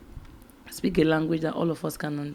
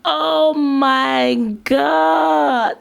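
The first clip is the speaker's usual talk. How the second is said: a long high "Oh my God" melting into laughter; loud, drawn out and thrilled, then laughing; delighted laughing; 9 semitones higher, 8 dB louder, much slower than usual. Real, not acted. Vlogger reacting to a clip she loves, laughing and loud.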